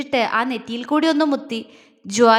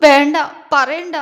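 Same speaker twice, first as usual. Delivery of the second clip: loud, slow sobbing no, don't tell me; sobbing plea; 7 dB louder than usual. A narrator's reading. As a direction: beg him to stop through sobs. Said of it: "അവൾ തേങ്ങലോടെ പറഞ്ഞു". (തേങ്ങലോടെ, ഉറക്കെ, പതുക്കെ)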